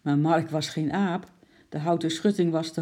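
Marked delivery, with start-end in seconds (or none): none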